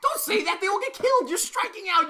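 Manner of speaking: alarmed outburst. Real, not acted. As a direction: yelp it out to stop your cohost mid-joke. Cohost panics at a bad reference.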